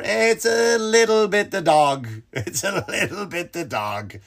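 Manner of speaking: slow, drawn-out singsong needling; teasing rebuttal. Acted. Comic answers dog lovers, drawn-out teasing.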